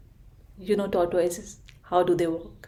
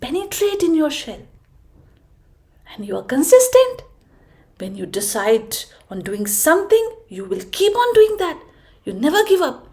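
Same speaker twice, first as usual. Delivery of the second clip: wise giraffe; 3 semitones higher, 4 dB louder than usual. Acted. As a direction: lift him up like a wise friend. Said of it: "and then said".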